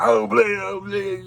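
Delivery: high, loud huffy voice, overdone; mock prissy refusal. Mimicking people who refuse to play the pause game, huffy mock voice. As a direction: play the offended refuser, hammed-up. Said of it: "Some people are like".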